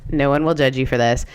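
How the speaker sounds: ordinary talk, nothing marked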